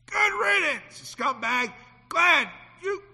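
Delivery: high, loud, slow shout; bitter riddance. Retold or imitated. Parent shouting good riddance at the child, loud. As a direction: spit it out at the leaving child.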